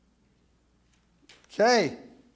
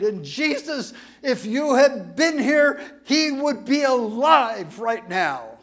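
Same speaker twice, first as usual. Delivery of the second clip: higher, louder wailing lament; voicing grieving Mary; 5 semitones higher, 4 dB louder than usual. Pastor voices Mary wailing over her dead brother. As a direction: cry it out like a grieving sister. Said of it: "Mary is there and she's saying".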